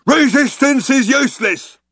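high, brutish guard's war cry; shouting. A narrator's reading. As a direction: shout it like a mindless slogan. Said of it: "the guard shouting again".

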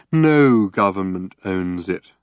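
usual speaking voice

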